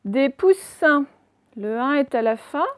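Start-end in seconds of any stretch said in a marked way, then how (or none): 0.0-1.0 slow, loud, higher dictation; deliberate classroom word reading; syllable by syllable. Shown as a demonstration. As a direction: sound the word out slowly for pupils. Teacher sounds out exercise word.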